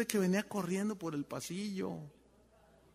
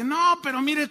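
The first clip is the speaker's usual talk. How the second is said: insistent pleading; 8 semitones higher, 8 dB louder than usual. Retold or imitated. Insiste al esposo, retold.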